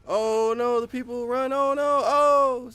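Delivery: high-pitched play-along alarm; mock panic. Gamer reacting to people running on screen, mock panic. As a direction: wail it high, hamming up the alarm.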